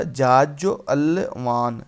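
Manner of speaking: जाजलवान stretched out slowly, each syllable separate; slow spelling demo. Shown as a demonstration. Teacher slowly dictating a tricky word to students. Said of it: "कैसे लिखा था?".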